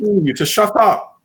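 high, drawn-out London "shut up"; English accent. Retold or imitated. Joking that the insult sounds polite, hammed-up English accent. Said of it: "even the way they say that is polite".

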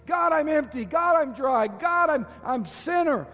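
high, loud plea for help, slowing at the end; pleading prayer. Pretend. Congregation praying aloud for help, a loud high plea. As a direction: beg God for help. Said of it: "we're gonna say this to the Lord".

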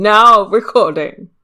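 slow, loud robotic announcement; stretched, exaggerated bot call-out; loud mock bot announcement. Mimicking bot, louder.

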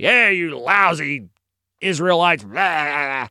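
drawn-out, growly mock yell; Goliath. Giant insulting the Israelites, mock yelling. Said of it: "he hears Goliath yelling across the valley".

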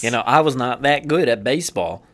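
low, slow drawled country voice; southern drawl. Pretend. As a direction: drawl like a lazy ballpark yokel. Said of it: "fucking voice".

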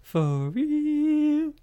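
very high, stretched-out "real"; put-on squeal. Co-host joking during the recap, silly high squeal. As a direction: swoop up into a long silly high note.

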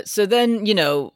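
ordinary talk, nothing marked

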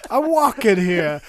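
put-on New York accent. Host impersonates a New Yorker on the street, slow loud New York accent. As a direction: bark it like an angry New York pedestrian. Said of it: "Typical New Yorker".

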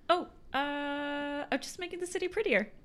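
high-pitched, innocent excuse; caught-out innocent. Acted. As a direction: play it innocent when caught in the act. Player character caught putting up stickers.

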